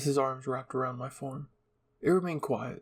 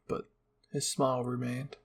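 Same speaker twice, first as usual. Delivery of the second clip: usual speaking voice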